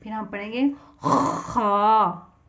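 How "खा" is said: खा with a scrape in the throat, louder; kha with a slight throat rasp. Shown as a demonstration. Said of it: "हलक में से आवाज़ निकलेगी".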